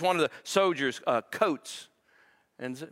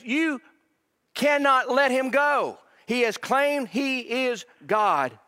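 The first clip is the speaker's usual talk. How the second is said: much higher, loud and slow; insistent demand; 10 semitones higher, 5 dB louder, much slower than usual. Retold or imitated. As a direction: drive every word home.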